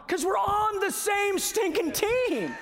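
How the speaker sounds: preaching shout. Real, not acted. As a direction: shout it out at the climax of the sermon. Preacher's climactic point.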